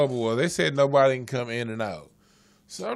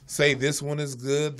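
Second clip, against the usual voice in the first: ordinary delivery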